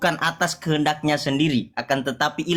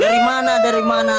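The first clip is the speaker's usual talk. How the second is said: sharp, raised challenge; loud demand; 4 semitones higher, 5 dB louder than usual. Real, not acted. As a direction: call it out loudly. Heated debate, demanding a source. (tanya dengan suara keras)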